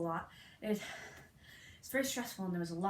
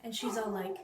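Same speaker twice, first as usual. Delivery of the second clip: usual speaking voice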